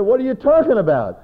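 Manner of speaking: high, loud mock disbelief; hammed-up indignant outburst; indignant, imitated. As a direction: throw it back in protest. Retold indignant reply to pastor.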